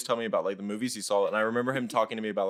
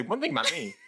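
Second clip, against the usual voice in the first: mock her catchphrase, a touch higher; mocking imitation; 8 semitones higher than usual. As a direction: tease her by parroting her line. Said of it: "You say that a lot".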